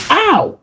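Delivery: high, sudden "ow"; pained yelp. Acted. Izuku is zapped mid-muttering, pained yelp. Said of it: "until he was zapped".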